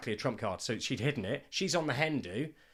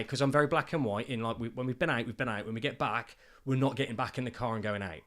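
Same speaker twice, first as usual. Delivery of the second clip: ordinary talk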